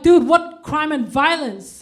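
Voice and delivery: slow, loud incredulous "dude"; drawn-out mock disbelief; exasperated retort. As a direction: scoff at the accusation. Wanted to say angrily to racist.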